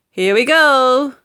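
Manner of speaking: cried out. Acted. George to Grandma as he tips the spoon: cheery slow call. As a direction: call it out like a boy starting a dare. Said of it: "George cried out".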